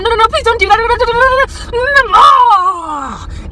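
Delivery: hammed-up begging; mock pleading. Pleading voice mocked.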